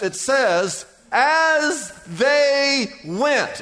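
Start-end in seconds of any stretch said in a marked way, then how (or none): 1.1-3.6 each word stretched out very slowly; slow, emphatic reading. Shown as a demonstration. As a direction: lean on "As they went" word by word, very slow. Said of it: "the most important line in this whole text".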